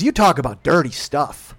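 loud, slow mom complaint; mock scolding mother. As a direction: spell out the accusation like an imitated mother. Mother scolding son, acted.

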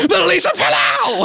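very high shouted echo; shouted hype echo. Pretend. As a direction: cry it out like an overexcited hype man. Hype man echoes product names.

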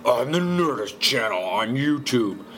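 slow, drawn-out promo announcer; mock-intense channel plug; intense commercial voice. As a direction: stretch it like an over-the-top ad. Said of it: "sound like my intense commercial voice".

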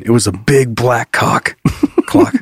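slowed, breaking into laughter; slow laughing read. Acted. Reading innuendo book.

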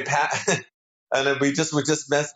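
ordinary talk, nothing marked

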